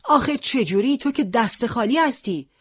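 high, loud disbelief; can't believe it, bare hands; astonished. Acted. Boy asks the unarmed fighter how, astonished. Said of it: "با تعجب گفت".

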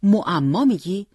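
exasperated. A narrator's reading. Husband to wife talking in riddles, exasperated. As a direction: draw the question out in irritation. Father exasperated by wife's puzzling answer.

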